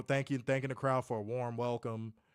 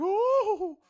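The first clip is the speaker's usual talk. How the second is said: imitating a squealed, much higher "Oh!" with a laugh; mock gasp of shock; 8 dB louder than usual. Imitating Chelsea Green's startled fallback, a high loud gasp. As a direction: ham up the wrestler's startled fallback. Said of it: "Chelsea Green does, like, this".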